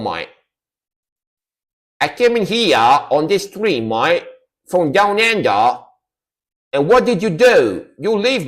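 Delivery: an Aussie scolding chat for leaving; mock Australian accent. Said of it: "it's got to have include mate".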